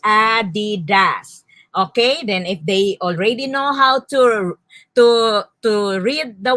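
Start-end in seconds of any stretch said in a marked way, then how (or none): 0.0-1.4 "Adidas" slowly in three beats, A-DI-DAS; three syllables. Shown as a demonstration. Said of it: "Adidas says three syllables".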